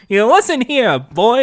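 put-on old-timey Hollywood American accent. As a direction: scold your son in old Hollywood style. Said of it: "it's kinda like old-timey Hollywood kind of accent".

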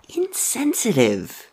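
high, slow eerie voice; ghostly dead boy. Acted. Dead boy scolding Henry, ghostly and slow. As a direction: drag it out like a ghost.

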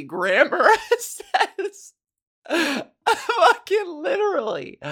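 laughing through the words; can't get it out for laughing; breaking up into laughter. Real, not acted. Laughing fit mid-sentence.

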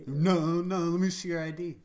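loud, disbelieving demand; loud disbelief. Retold or imitated. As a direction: call him out loudly. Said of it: "they're like".

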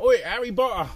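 high, loud jeering call; retold heckling shout. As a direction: re-enact shouting after someone in the street. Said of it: "You're shouting out, I'm shouting out".

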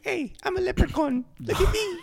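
very high, squeaky Irish leprechaun; bad Irish leprechaun impression; quick high-pitched stage Irish; mock Irish accent. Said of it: "that's, that's Irish".

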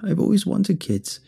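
put-on high, pleading old woman; tearful-sounding woman, mildly played; voice beginning to crack. A narrator's reading. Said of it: "her voice began to change, crackling, stuttering".

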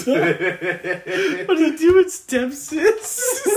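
high, loud words dragged through laughter; laughing. Real, not acted. Friend making a crude joke to the group, laughing. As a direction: barely get it out for laughing.

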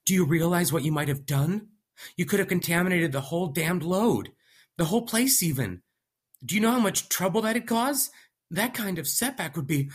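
high, loud panicked rant; frantic young scientist voice. Acted. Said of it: "he spoke frantically again".

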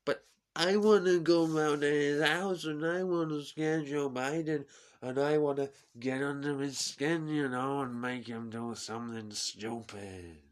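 put-on loud Trump accent, boasting a plan; scheming Trump impression. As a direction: brag it like Trump at a rally. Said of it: "I can't get out of his accent now".

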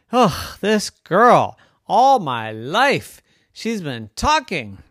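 slow, emphatic swooping complaint; mildly exasperated brother. Acted. Older brother complaining about his talkative sister, mild exasperation. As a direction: groan about it with drawn-out words.

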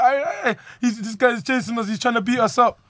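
put-on panicky kid. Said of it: "man almost had tears in his eyes".

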